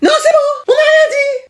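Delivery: high panicked backing down; panicked squeal. Acted. Twins squealing in panic at the teacher's threat. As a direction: squeal a frantic retreat.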